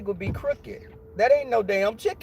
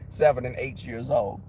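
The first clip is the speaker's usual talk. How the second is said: usual speaking voice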